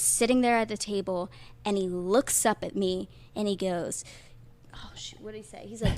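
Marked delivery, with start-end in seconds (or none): none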